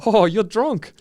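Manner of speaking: put-on high, amused Kiwi accent; sweet Kiwi. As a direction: imitate a friendly New Zealander, loud and high. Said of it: "He was the sweetest Kiwi guy".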